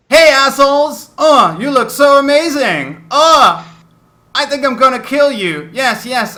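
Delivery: a giddy madman thrilled to kill you; shrieking unhinged little swordsman; manic exile. Acted.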